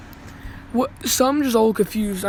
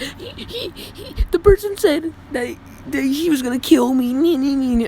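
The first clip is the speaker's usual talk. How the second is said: high whiny tattletale voice, pitched way up; mock whiny tattler; 6 semitones higher than usual. Kid mocking a classmate who tattles, whiny high voice. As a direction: whine like a little snitch telling on someone.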